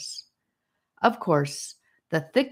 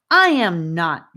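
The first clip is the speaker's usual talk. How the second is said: louder, indignant retort; heated retort; 8 dB louder than usual. A narrator's reading. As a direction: snap back, offended. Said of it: "Gabe retorted with feeling".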